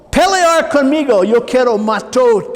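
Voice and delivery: loud, high retold yell of a furious father. Father beating his son yells at him, retold loud yell. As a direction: bark it like an enraged dad. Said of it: "Y él gritándolo".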